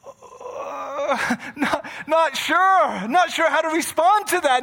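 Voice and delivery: flustered laughing protest; laughing nervously. Retold or imitated. Listener caught off guard, nervous laughing reply. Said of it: "And you're like".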